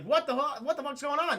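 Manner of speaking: high-pitched confused outburst; mock bewilderment. Gargoyles asking what is happening, bewildered. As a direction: act out a baffled reaction. Gargoyles waking to chaos, retold.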